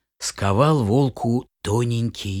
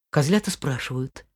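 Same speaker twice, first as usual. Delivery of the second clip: usual speaking voice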